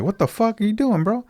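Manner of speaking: high-pitched mock protest; retold indignant disbelief. Retelling a knife confrontation, indignant.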